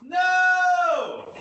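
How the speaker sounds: long, high-pitched wail of protest; hammed-up drawn-out cry of dismay; mock yelled no. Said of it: "What you yelling no for?".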